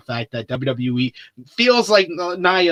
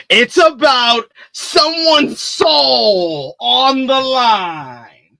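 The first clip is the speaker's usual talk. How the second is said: slow, booming high movie-trailer voice; milk the drama, stretch every word; dramatic announcer; 8 semitones higher, 7 dB louder, much slower than usual. Acted. Dramatic hype of the soul stakes.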